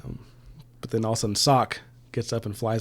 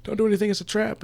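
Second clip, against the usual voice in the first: retold yelling; 10 semitones higher, faster than usual. Dusk warns Vati it's a trap, retold yelling. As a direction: imitate a frantic warning shout, lightly. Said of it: "he's essentially yelling".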